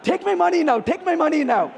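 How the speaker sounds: high, loud eager repeat; gleeful, sold on the movie; playful happy chant; excited. Retold or imitated. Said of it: "And I'm like".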